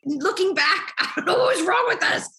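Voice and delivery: amused disbelief, high and laughing; laughing in hindsight. Real, not acted. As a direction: laugh at your own past decision, pitch jumping up. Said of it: "I'm laughing now".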